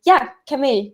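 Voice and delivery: ordinary delivery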